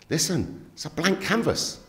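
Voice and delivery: retelling an eager rallying speech; impassioned pitch, retold. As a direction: pitch it up like selling a dream. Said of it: "he was going".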